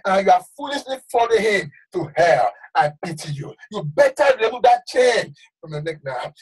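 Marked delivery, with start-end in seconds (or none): none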